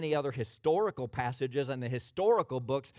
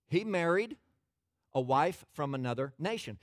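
ordinary talk

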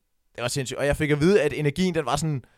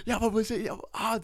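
high-pitched hype, no real volume; mock excited yelling; 8 semitones higher than usual. Guest outside telling friends afterwards, hyped mock yell. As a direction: imitate yourself giddily bragging. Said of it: "Jeg står udenfor og råber".

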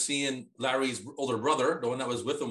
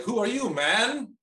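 slow, high dazed question; drawn-out high confused wake-up; groggy waking voice; 8 semitones higher, 4 dB louder, much slower than usual. Retold or imitated. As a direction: stretch it out, half-awake and lost. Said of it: "he's like".

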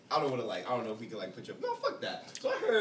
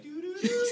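mock phone tone. Imitating phone call sound.

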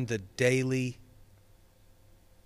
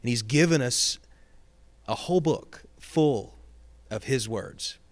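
louder, impassioned preacher's call; preaching; 5 dB louder than usual. Real, not acted. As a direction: preach it out, building in force. Pastor's exhortation grows to preaching.

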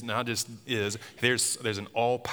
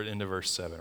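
ordinary talk, nothing marked

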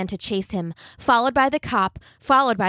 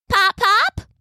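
high call to a distant husband; 13 semitones higher, 4 dB louder than usual. Acted. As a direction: call out across the yard. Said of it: "she called out the window".

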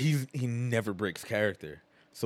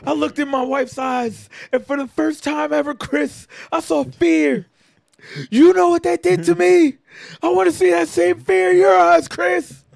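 much higher and louder, building to a yell; hammed-up intense promo; 9 dB louder than usual. Imitating Eddie's threat to Chris, high and loud. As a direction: deliver it like a menacing wrestling threat. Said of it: "goes into some long monologue".

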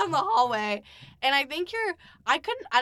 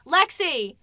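louder, higher call of the name; 5 semitones higher, 6 dB louder than usual. Retold or imitated. Friend calling her name through the door, loud. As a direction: call her from the living room. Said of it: "he's like".

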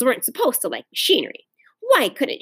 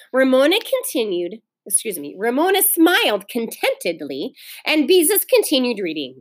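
usual speaking voice

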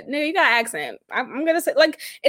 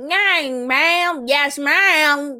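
country twang; 3 semitones higher, much slower than usual. Retold or imitated. Imitating her caller's twang, mock country accent. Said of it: "the twang in his voice".

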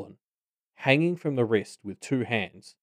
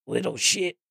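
restrained angry snarl. A narrator's reading. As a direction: snarl the insult through the teeth.

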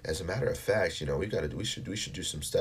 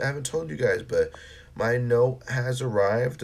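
ordinary talk, nothing marked

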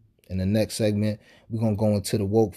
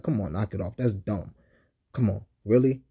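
ordinary delivery

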